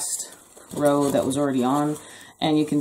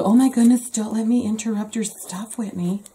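mom voice; 6 semitones higher than usual. Acted. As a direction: put on an exaggerated, indignant mom. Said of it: "That's my mom voice".

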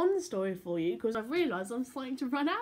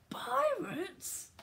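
high astonished exclaim; high, amazed question; surprised. Acted. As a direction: pop up in surprise.

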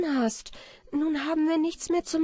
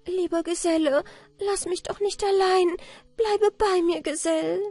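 high, rising, urgent plea; tearful pleading; 11 semitones higher, 4 dB louder than usual. A narrator's reading. Anna Barbara begs the dying companion to stay, pleading. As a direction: beg him to stay, high and louder. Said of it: "ihre Augen gingen über von den herzlichen Mitleidstränen".

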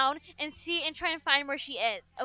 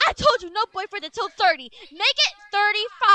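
loud, higher scolding; gasp, then lay down the law; mother adding years to the rule; outraged gasp; 5 semitones higher, 11 dB louder than usual. Acted.

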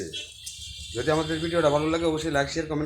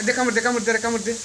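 playful egging on; 6 semitones higher than usual. Real, not acted. Owner daring her cat to bite, high and playful.

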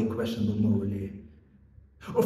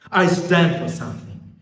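high, loud, proud insistence; forceful declaration; 7 semitones higher, 7 dB louder than usual. Acted. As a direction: punch it out with conviction. Policeman declares his principle.

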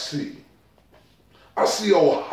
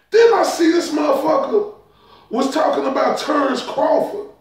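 indignant shout; 8 semitones higher than usual. Real, not acted.

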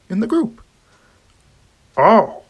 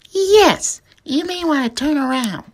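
ordinary delivery

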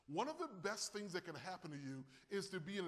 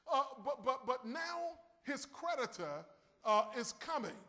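raised preaching voice, higher and louder; 3 semitones higher, 5 dB louder than usual. Acted. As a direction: preach the widow's words out with force. Preacher building again.

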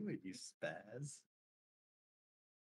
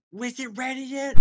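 daughter voice; 11 semitones higher, 7 dB louder than usual. Retold or imitated. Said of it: "She's like".

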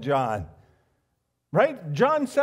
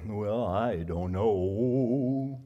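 very slow, low mopey Eeyore; lightly voiced Eeyore moping; 8 semitones lower, much slower than usual. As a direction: drag it out like a gloomy donkey. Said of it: "turning into Eeyore".

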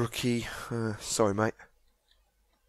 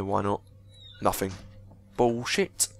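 ordinary talk, nothing marked

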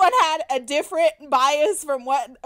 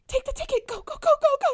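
high-pitched, insistent hustling; urgent urging; 13 semitones higher, much faster than usual. Retold or imitated. Friend sending her up with the ticket, urgent urging. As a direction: imitate a friend pushing you to go.

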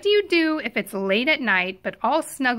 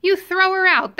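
higher, louder punchline; emphatic raised refrain; 6 semitones higher, 5 dB louder than usual. A narrator's reading. Reader answering the refrain to children, louder and emphatic. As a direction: proclaim it with gusto.

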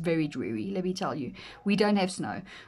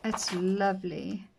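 ordinary delivery

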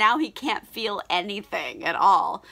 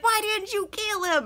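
much higher put-on lady's voice; incredulous lady; 12 semitones higher than usual. Opium den lady confronting James, mock incredulous. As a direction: demand an answer in a high mock voice.

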